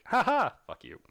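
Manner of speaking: high, loud mock villain laugh into a taunt; spring the gloating villain's taunt, loudly hammed up; Mysterio. Podcaster imitating the villain's turn on everyone, high gloating taunt. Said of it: "he played this, the really weird".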